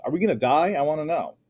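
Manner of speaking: put-on a frightened girl shouting at her father. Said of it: "this time I shouted".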